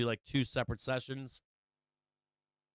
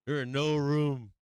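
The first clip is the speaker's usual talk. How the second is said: mock stern decree; 2 semitones higher, 5 dB louder than usual. Emphatic repeat of the rule.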